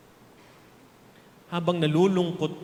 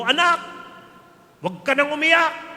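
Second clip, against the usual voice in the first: put-on loud, higher, stern pep talk to a crying child; bracing parent; 7 semitones higher, 8 dB louder than usual. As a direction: tell the kid to toughen up, loud and firm. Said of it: "tapos sinabi mo".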